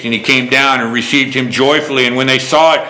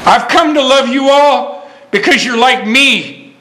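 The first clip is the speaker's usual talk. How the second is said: tender; 9 semitones higher, slower than usual. Retold or imitated. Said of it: "my pastor, Gene Harmon, to say".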